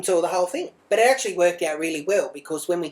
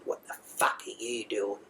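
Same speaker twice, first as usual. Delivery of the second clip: much lower and far softer, hushed; real quiet voice; 8 semitones lower, 9 dB quieter than usual. Retold or imitated. Mum threatening a stranger's child, hushed and menacing. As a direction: hiss it low so the mum can't hear. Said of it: "a real quiet voice".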